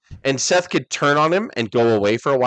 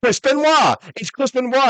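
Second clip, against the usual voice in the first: high, louder mocking voice; Rock impression; 13 semitones higher, 3 dB louder than usual. Friend mimicking The Rock mocking Benoit, high and gleeful. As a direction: crow it like a cocky heel. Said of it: "He's like".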